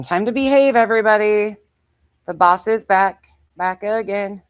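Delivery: playful mock decree to the dogs; mock announcement. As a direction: announce the boss's return like a herald. Announcing owner's return to dogs.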